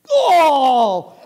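high, loud, drawn-out groan; disgusted wail. Acted. Owner finds dung on his dog, disgusted wail. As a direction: cry out in horrified disgust. Owner discovers it is not mud.

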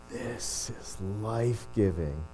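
slow, soft, low savouring; hushed, drawn-out contentment. Acted. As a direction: breathe it out slowly, as if soaking it in. Acting out a delighted extrovert.

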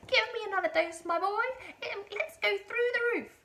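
high, loud, excited granny screech; screechy old woman. A narrator's reading. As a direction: screech your demand to the boy below. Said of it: "the old screechy voice from up above".

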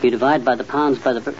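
muttered working-out, softening as it goes; muttering. Acted. Husband working out turkey cooking time, muttering to himself. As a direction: mumble sums to yourself, halting between steps. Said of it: "what are you muttering about".

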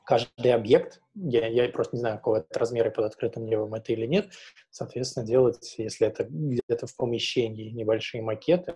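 usual speaking voice

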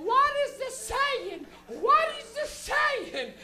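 high, slow, loud mother voice; baffled yelling mom. Retold or imitated. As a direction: imitate mom drawing out each word. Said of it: "I remember my mom going".

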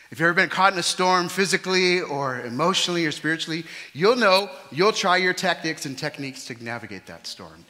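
loud, high preaching; preaching at full force. Acted. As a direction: preach it out to the hall.

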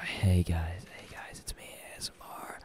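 hushed ASMR whisper. Shown as a demonstration. Streamer testing whisper on the mic, whispering. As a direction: whisper a greeting very softly. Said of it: "I have to whisper".

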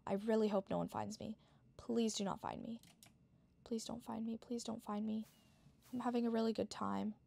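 hushed pleading whisper; whispering while hiding. Real, not acted. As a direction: keep it barely audible so nobody hears. Hiding, hoping not to be found.

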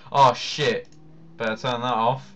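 ordinary delivery

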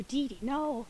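half-hearted cartoon brother voice; Dexter impression. Streamer as Dexter telling Dee Dee no, weak imitation. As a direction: weakly mimic a scolding brother. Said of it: "not very good at doing Dexter's voice".

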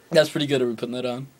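usual speaking voice

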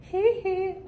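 very high, soft falsetto "he, he"; tiny falsetto pop-star yelp. Acted. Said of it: "Is this the ghost of [name]".

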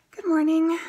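bright, loud and high hello; cheery greeting. Real, not acted. Vlog greeting.

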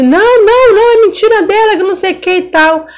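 the caught uncle denying in a high panic; panicked denial. Retold or imitated. (negação em pânico, bem aguda)

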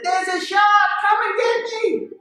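very high, loud retold cry; urgent shout for help. Diver to friends in the boat about a shark, high urgent shout. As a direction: shout across the water to be rescued.

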